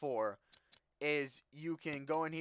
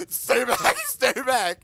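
laughing, louder and much higher; laughing stay back; 11 semitones higher, 14 dB louder, much slower than usual. Real, not acted. Gamer laughing at the zombie coming for him. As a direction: giggle while fending it off.

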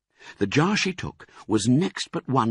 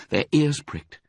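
ordinary delivery

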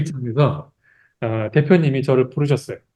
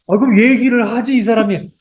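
much higher-pitched mock reproach; imitated exasperated boss; 7 semitones higher than usual. Boss who wrongly scolded an employee, imitated exasperated chiding. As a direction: chide the employee as the embarrassed boss. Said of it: "그분이 다시 부르셔서".

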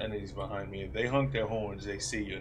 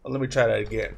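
laughing; 11 dB louder, faster than usual. Real, not acted. Laughing while talking.

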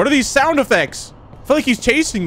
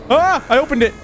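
panicked high-pitched; 10 semitones higher than usual. Real, not acted. Monster chasing faster now.

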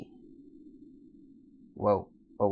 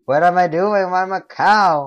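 high, loud wail of disbelief; dismayed shout; 7 semitones higher, 12 dB louder than usual. Pretend. Player asks why he turned into a cow, dismayed shout. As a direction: cry out in mock despair.

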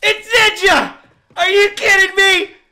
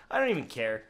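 normal talking voice

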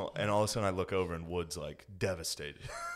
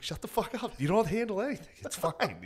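mock exasperated yell; 12 semitones higher than usual. Brushing off his friend's worry, high exasperated yell. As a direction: throw it at him like an impatient friend.

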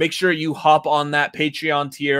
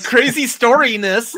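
mock gesture voice; 9 semitones higher, 3 dB louder than usual. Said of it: "I'm all like".

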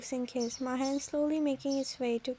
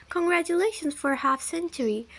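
higher-pitched mock congratulations; sarcastic; 4 semitones higher, 3 dB louder than usual. A narrator's reading. Wife to the husband who spanked her, sarcastically. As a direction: congratulate him with a touch of sarcasm. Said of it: "I said sarcastically".

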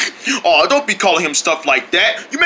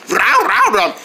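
barking dog; 11 semitones higher than usual. Acted.

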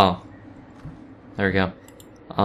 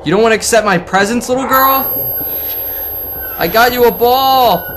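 high, fast, loud taunting; exasperated shouting; 11 semitones higher, 3 dB louder than usual. Real, not acted. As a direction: yell at a stubborn ghost. Taunting ghost girl.

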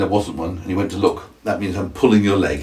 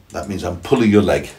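plain reading voice

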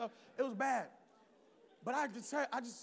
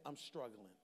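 quiet admission; 10 semitones lower, 9 dB quieter than usual. Acted. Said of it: "you're gonna have to admit".

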